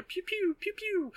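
high, soft mock laser noises; pew pew sound effects. Spells flying in the fight, high soft pew noises. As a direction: make toy blaster sounds. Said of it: "shoot magic at each other and go".

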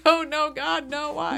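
high, hammed-up plea with a laugh behind it; mock pleading, high; mock despair. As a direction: play a lightly voiced doomed victim. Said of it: "And you're like".

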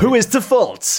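high, loud dramatic question; mock crime statement. True-crime parody about the assault, ringing narrator question. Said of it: "can I do a statement".

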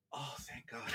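breathy hushed whisper of relief; relieved whisper. Retold or imitated. Tom alone, realizing he is saved, whispering. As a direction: sigh it out under your breath, saved. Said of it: "Tom is just like".

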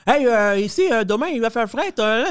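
high-pitched parody of a TV forecast; playful high-voiced news impression; mock Québécois newscaster. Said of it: "des nouvelles ... puis tu entends".

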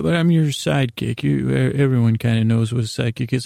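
ordinary talk, nothing marked

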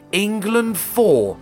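normal talking voice

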